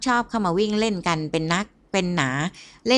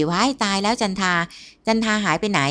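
usual speaking voice

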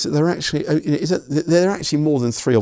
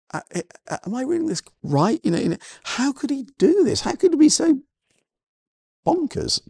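high, rising disbelief; can he really have done this; 11 semitones higher than usual. Real, not acted. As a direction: pitch climbing in shocked disbelief. Preacher incredulous at servant's cruelty.

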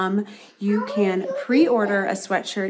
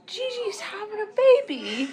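tiny high-pitched announcement; imitated excited boy; 13 semitones higher than usual. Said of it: "he goes".

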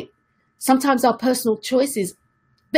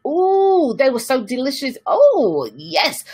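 swooning delight; 3 semitones higher, slower than usual. Retold or imitated. Speaker mimics someone smitten with a new partner, swooning. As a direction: gush like someone newly besotted.

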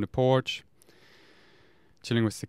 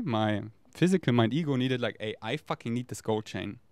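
usual speaking voice

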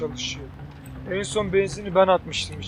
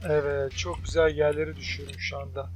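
ordinary delivery

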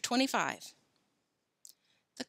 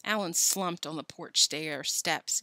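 usual speaking voice

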